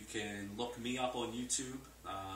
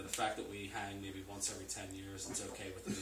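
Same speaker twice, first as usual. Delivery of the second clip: ordinary delivery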